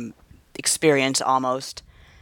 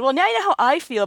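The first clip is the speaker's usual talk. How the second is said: higher, quick triumphant comeback; mock retort; 12 semitones higher, faster than usual. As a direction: land the comeback smugly. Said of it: "I said".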